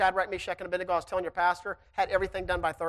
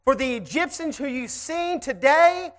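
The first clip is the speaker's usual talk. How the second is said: slow, emphatic scripture read; solemn preacher's reading; preaching scripture; 5 semitones higher, 7 dB louder, slower than usual. Acted. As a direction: lay each word down slowly.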